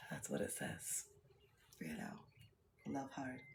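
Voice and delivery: barely voiced, reading the words to herself; hushed whisper of the saying; soft whisper. Real, not acted. Reads quote softly.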